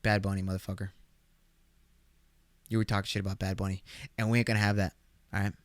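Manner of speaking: low, soft mock threat; mock menacing. Son warns dad about [name], low mock menace. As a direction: drop low and warn him.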